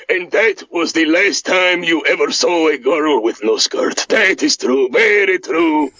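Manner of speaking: put-on deadpan Eastern European accent; Boris voice. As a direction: deliver the jab flatly in the accent. Boris punchline.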